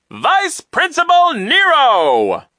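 high, drawn-out grand proclamation; loud announcement. A narrator's reading. Man introducing himself grandly, high and loud. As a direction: proclaim the name slowly at full volume. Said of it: "announced in a loud voice".

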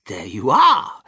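loud, rising cry of "there you are". A narrator's reading. Wonka showing the visitors his sweets, crying out. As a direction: call it out proudly, voice lifting at the end. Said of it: "cried Mister Wonka".

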